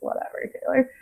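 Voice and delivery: imitated dismissive shrug, softly tossed off; sarcastic brush-off. Friend shrugging off her excuse, sarcastic. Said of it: "sarcastic way, just kinda shrugged me off".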